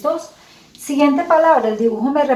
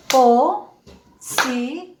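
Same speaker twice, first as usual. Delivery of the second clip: "Pocillo" very slowly, po... ci... llo; syllable by syllable; much slower than usual. Shown as a demonstration. Teacher asks how many voice beats, syllable by syllable. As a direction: break "Pocillo" into three long beats. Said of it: "Cuántos golpes de voz".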